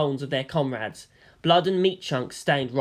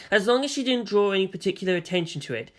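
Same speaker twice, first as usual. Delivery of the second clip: plain reading voice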